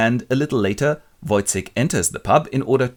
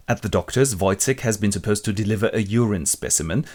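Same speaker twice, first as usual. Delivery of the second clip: ordinary talk, nothing marked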